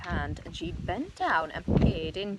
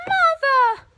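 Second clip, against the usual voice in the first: loud, much higher anguished wail; 14 dB louder than usual. Acted. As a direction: wail her name in horror. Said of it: "wailed Missus Cranky".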